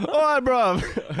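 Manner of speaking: put-on slow, high, laughing British accent; laughing mock British. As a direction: laugh through a cheeky British greeting. Echoing the British joke, laughing.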